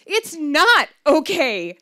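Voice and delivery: upset wail of protest. Retold or imitated. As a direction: cry it out, protesting. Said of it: "I wailed".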